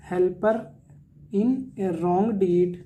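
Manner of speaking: very slow, word by word; slow definition read for notes; slow dictation. Shown as a demonstration. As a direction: dictate each word slowly. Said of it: "repeats definition slowly".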